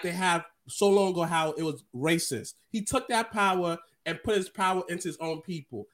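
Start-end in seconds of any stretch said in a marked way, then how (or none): none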